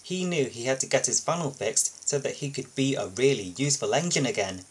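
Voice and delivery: ordinary delivery, nothing marked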